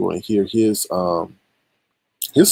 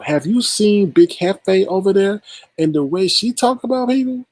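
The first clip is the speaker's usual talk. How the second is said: way up high, outraged and pressing; high heated rant; 12 semitones higher than usual. Real, not acted. Vlogger protesting to her rival, high and outraged. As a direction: push it up high in outrage at the lie.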